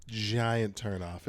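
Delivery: usual speaking voice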